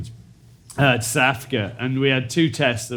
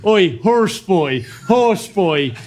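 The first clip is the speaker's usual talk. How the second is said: slow, higher, louder taunt; mocking jeer; 4 semitones higher, 3 dB louder, much slower than usual. Senior player taunting a young teammate, slow higher jeer. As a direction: jeer it across the room. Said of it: "literally going".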